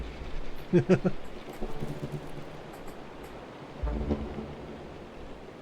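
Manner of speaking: soft low unvoiced laugh; breathy laugh. Real, not acted. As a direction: laugh quietly under the breath. Laugh at the cat.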